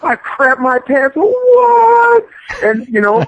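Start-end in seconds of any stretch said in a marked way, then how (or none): 1.2-2.2 high, loud shocked yelp; recalling Vader's entrance, a loud startled What. Retold or imitated. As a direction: jump in pitch like you just got startled. Shocked exclamation.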